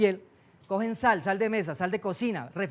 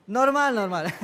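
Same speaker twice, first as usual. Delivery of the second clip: laughing; slower than usual. Real, not acted.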